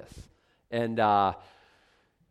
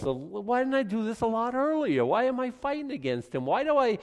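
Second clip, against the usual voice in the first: much higher, pleading self-reproach; mock regretful self; 11 semitones higher, 3 dB louder than usual. Preacher voicing a believer who finally gave in, higher and pleading. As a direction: ask yourself why you waited.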